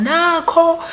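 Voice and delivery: high, sing-song call of a name; a mother's high calling voice. Retold or imitated. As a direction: call the child from a distance like her mother. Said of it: "it's my mother's voice".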